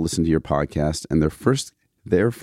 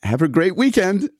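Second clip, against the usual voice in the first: chirpy sign-off; 11 semitones higher than usual. Retold or imitated. Reading listener's chirpy sign-off.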